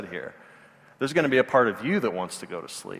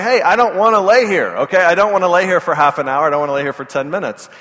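louder, higher fed-up dad; exasperated protest; 5 semitones higher, 8 dB louder than usual. Retold or imitated. Dad protests to his daughter, loud and exasperated. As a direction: push back, fed up with the game.

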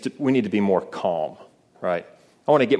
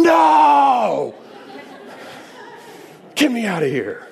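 a sudden high, loud, desperate cry of "No!"; panicked inner scream; 13 semitones higher, 3 dB louder, slower than usual. Retold or imitated. Comic inner voice of a man in the DMV line, screaming to escape. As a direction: scream to be let out. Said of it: "And he said, "Inside, everybody's going".

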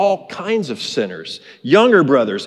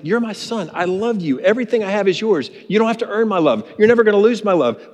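loving father; 7 semitones higher than usual. Retold or imitated. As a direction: coax the son with love. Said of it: "He's like".

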